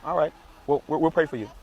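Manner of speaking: ordinary talk, nothing marked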